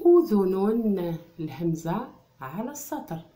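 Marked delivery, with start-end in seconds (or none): 0.1-1.2 very slow and much louder أذن; drawn-out loud word. Shown as a demonstration. Teacher presenting the word to the class, drawn out and loud. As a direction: call أذن out long and loud. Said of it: "teacher sounds out the word".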